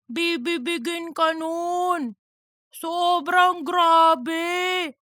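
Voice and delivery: mocking, slow put-on voice reading a post aloud; reading like a fool as Cheese. Duga reads Cheese's chirp aloud to Darren, in a mocking slow voice. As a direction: ham it up like a fool, dragging the words. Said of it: "magbasa si Duga ng parang tanga".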